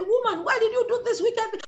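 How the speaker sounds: high-pitched acted reproach; storyteller's incredulous, high-pitched scolding; the Lord questioning Eve. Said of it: "And the Lord said".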